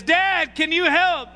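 imitating the little boy asking dad for help. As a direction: call up to dad like a small kid. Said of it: "and he says".

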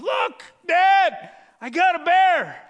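put-on high, loud boasting boy, bear trophy; proud kid. Boy showing dad the bear's head, high loud boast. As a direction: crow about it to Dad.

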